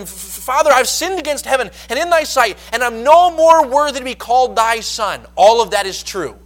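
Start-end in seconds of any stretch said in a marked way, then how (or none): 0.0-5.2 higher, louder, stuttered plea; the prodigal son stammering before his father; stammering confession. Retold or imitated. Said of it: "trying to get through his confession".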